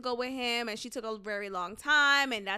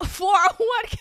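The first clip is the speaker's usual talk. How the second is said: laughing shout; 10 semitones higher, 8 dB louder than usual. Real, not acted. Cracking up mid-rant.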